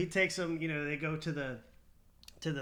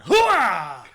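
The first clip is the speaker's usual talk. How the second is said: slow, booming army hoorah; loud gung-ho soldier; 5 semitones higher, 17 dB louder than usual. Pretend. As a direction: bellow it like a proud marine. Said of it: "my dad would probably kill me".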